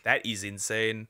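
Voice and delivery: usual speaking voice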